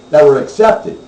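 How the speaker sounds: "accepted" hit hard and loud; stress on accepted. Real, not acted. Radio host clarifying twenty-four penalties, stress on "accepted". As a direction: punch the word "accepted" to set it apart.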